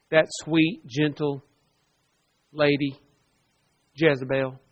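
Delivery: sweet, gentle said with a quiet, knowing irony; dry sarcasm. Retold or imitated. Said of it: "that's more sarcasm".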